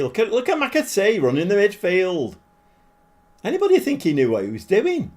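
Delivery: ordinary talk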